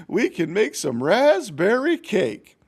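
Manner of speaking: high, slow hen voice; raspberry stretched out eagerly; excited hen. Acted. Hen proposes cake.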